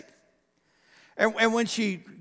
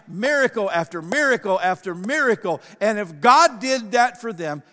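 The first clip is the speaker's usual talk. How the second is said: louder, rising preacher build, miracle after miracle; preaching at full force; 3 semitones higher, 6 dB louder than usual. Real, not acted. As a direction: preach it out to the congregation with growing force.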